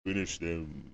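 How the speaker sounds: low game announcer finisher; Mortal Kombat style. Retold or imitated. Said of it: "Mortal Kombat style".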